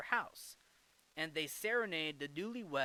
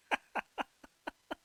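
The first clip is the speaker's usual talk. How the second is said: giggle; 4 dB louder than usual. Real, not acted. Giggle at Ernie and Bert names.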